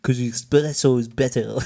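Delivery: loud mock French accent; hammed-up French accent. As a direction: imitate a Frenchman's accent, loudly. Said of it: "I'm not gonna do his accent".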